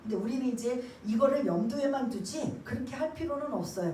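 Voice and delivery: ordinary delivery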